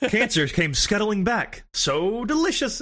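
hammed-up stilted kid line reading; imitated game-dub kid. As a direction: stretch the words like a badly dubbed child. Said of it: "the delivery that kid did was, like, hilarious".

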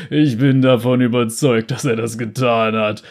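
loud, laughing mockery; thundering, laughing. A narrator's reading. Bounty hunter mocks the girl, loud and laughing. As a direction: boom it out through laughter. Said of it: "donnerte er".

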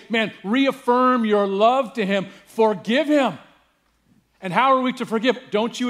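loud, high, ringing preacher's call; full-force preaching. Acted. Pastor preaching to the congregation with full-force fervor. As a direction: drive the point home to the whole church.

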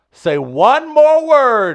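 high, loud, very drawn-out imitation; challenging dare. Someone goes to the edge with him, daring. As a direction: stretch the dare out slowly.

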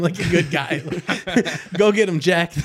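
laughing through a cheer; giggling while voicing the boss.

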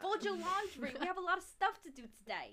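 put-on loud, high, bossy Staten Island accent; Staten Island mom. Mom handing out laundry chores, loud Staten Island accent. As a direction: boss the kids around as a Staten Island mom. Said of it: "Staten Island mom".

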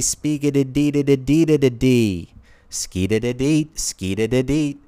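high scat-singing character voice, playful; Speakeasy Tom voice. Acted. Speakeasy Tom character scatting nonsense syllables for the crowd. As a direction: bop out the nonsense like a jazzy scat singer. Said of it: "capable of that exact accent".

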